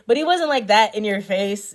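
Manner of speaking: ordinary delivery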